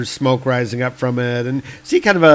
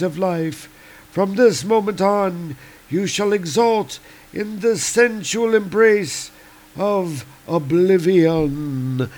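sinister god Seth; 7 semitones higher than usual. Acted. Serpent god to doomed woman, higher and gloating. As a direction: savor each cruel promise.

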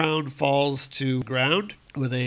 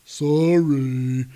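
apologetic giant; 2 semitones higher, 6 dB louder than usual. Acted.

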